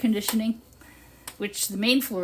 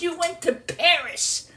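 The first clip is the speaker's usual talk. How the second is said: teasing retort; 8 semitones higher, 4 dB louder, slower than usual. Retold or imitated. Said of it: "I said".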